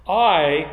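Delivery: a firm emphatic I; stress on I. Shown as a demonstration. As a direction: punch the word I before the rest.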